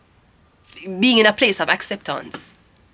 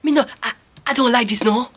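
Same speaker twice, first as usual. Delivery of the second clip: lightly mocked African accent, higher and louder; 3 semitones higher, 6 dB louder than usual. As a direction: put on a hint of an African accent, raised pitch. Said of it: "You hear an African person, that a-, accent".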